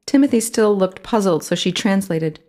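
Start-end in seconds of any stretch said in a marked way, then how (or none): none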